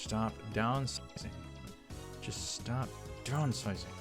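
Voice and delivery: usual speaking voice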